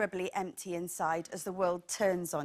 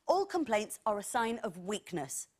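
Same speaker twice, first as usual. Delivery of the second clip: ordinary delivery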